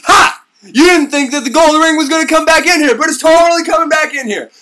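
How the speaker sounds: loud, high triumphant gloat. Retold or imitated. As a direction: crow about the twist. Odin gloating.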